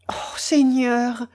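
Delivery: slow drawn-out moan to the Lord; a slow, pained lament. A narrator's reading. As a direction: groan it out in despair. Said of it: "gémit-elle".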